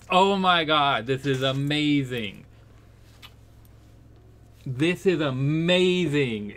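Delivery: loud, slowed awe at the reveal; excited amazement. Real, not acted. Player amazed the priest is Smash Mouth, loud excited disbelief. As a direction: shout out in delighted disbelief.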